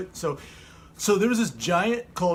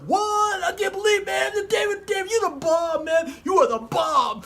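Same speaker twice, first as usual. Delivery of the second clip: very high, shouted amazement; comic shrieking disbelief, easing slightly at the end; shocked; 14 semitones higher than usual. Acted. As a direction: squeal in awe at the hero.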